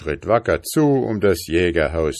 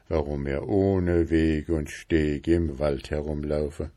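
ordinary delivery

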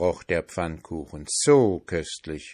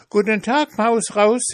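high, boastful pancake voice; cheeky pancake; 8 dB louder than usual. A narrator's reading. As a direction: taunt them from high up. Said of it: "sagte der Pfannkuchen".

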